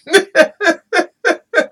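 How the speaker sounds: high staccato giggle; real high-pitched burst of laughter. Real, not acted. Laughs at her own point.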